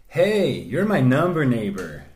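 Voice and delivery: slow, drawn-out American greeting; put-on American accent. Acting out a text to a number neighbour, American accent. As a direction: play an American texter saying hi. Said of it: "all of these Twitter exchanges are American".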